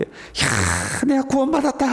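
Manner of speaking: high, drawn-out cry of joy; joyful shout. Acted. Saved believer announcing salvation, joyful cry. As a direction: cry out in acted jubilation. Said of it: "즐겁게 소리칠 줄 아는".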